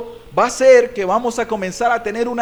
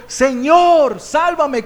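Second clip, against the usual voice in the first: high, urgent shout for help; loud desperate cry; 6 semitones higher, 4 dB louder, much slower than usual. Acted. Peter exclaiming to the Lord for rescue, crying aloud. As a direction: cry out in great need. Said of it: "en alta voz diciendo".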